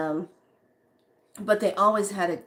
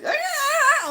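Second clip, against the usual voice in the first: mock cat cry; 5 dB louder than usual. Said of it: "then she starts".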